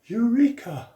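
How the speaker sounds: high, loud mock shout; shouted eureka. Archimedes shouting in his bath, mock triumphant shout. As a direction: cry it out like a discovery. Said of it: "he shouted out".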